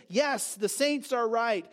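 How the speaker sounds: ordinary talk, nothing marked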